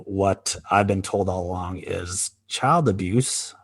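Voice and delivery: usual speaking voice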